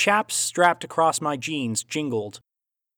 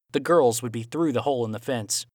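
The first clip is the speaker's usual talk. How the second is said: ordinary delivery, nothing marked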